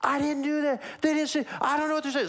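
high-pitched, frantic mock protest; losing your mind. Falsely accused man before a shouting crowd, acted panic. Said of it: "you'd be losing your mind".

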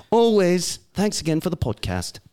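put-on British sign-off, lifted pitch; British accent. Co-host closing the listener's email, British accent. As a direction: sign off as a mock Brit. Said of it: "read it in a British accent".

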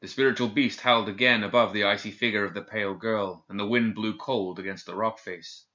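ordinary delivery

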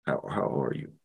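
low, soft, halting check-in; imitating concerned dad. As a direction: retell a father's gentle concern, voice dropped low. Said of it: "he's just worried about whether".

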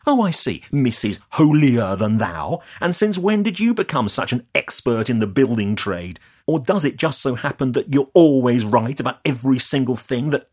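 mock her and let the anger boil over; losing it. Developer losing his temper at Leah, loud sarcastic tirade. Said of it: "I lost it at this point".

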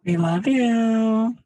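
put-on high, slow girly voice; girl voice. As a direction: coo it sweetly like a girl. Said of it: "Do the girl voice doing the kiss sound".

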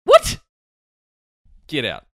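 ordinary talk, nothing marked

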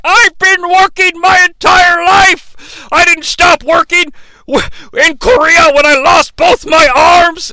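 hammed-up grumpy shout; ranting Uncle Claus. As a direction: bellow like an aggrieved old vet. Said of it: "Uncle Claus is like".

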